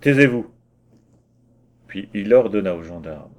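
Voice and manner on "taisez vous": loud, severe silencing; stern. Acted. Mayor silencing the insolent worker, stern and loud. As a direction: shut him up with authority. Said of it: "prit un air sévère".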